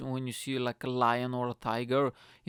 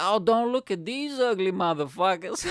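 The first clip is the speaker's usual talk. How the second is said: louder, higher jeer; loud mocking jeer; 6 semitones higher, 6 dB louder than usual. Boyfriend jeering at the shoes in the shop, loud. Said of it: "And I was like".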